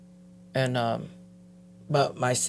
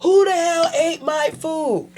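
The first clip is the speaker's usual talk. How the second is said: raised voice; 11 semitones higher than usual. Retold or imitated. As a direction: call the whole house out over the food. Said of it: "anybody else would raise their voice".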